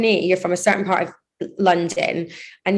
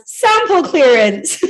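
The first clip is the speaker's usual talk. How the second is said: mock-dramatic; 7 semitones higher, 5 dB louder, much slower than usual. Real, not acted. Mock-grand naming of a setback.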